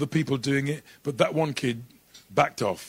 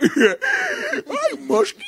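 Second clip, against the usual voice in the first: very high cartoon voice; squeaky cartoon dog line; Deputy Dog; 5 dB louder than usual. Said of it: "Deputy Dog".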